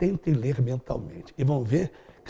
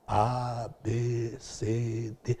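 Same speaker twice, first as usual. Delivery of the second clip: reciting the alphabet; 4 semitones lower, 5 dB quieter, much slower than usual. Shown as a demonstration. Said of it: "Ela me ensinou o alfabeto".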